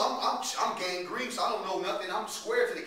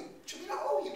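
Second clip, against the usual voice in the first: indignant denial; 11 semitones higher, 3 dB quieter, faster than usual. Acted. Acted dispute over a debt.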